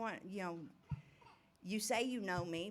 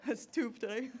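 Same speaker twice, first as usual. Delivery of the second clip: high giggles around a short line; real jittery laughter; nervous giggle; 7 semitones higher than usual. Real, not acted. Nervous over coffee, giggling.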